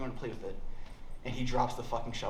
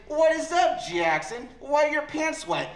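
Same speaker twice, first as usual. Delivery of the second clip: higher, louder mom asking, slow at the end; mom voice, suspicious; 12 semitones higher, 6 dB louder, slower than usual. Retold or imitated. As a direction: play the suspicious mother questioning her son.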